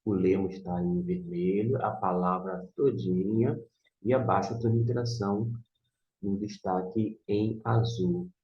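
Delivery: usual speaking voice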